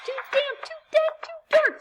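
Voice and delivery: very high, tiny squeaking mouse voice; squeaky mouse. Acted. Little mouse squeaks in the dark cave, very high and squeaky. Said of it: "Mouse squeaks".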